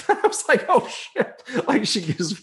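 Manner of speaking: high, laughing retold alarm. Real, not acted. Storyteller recalling the policewoman's sign of the cross, laughing.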